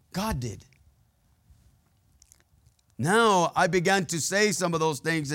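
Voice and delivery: normal talking voice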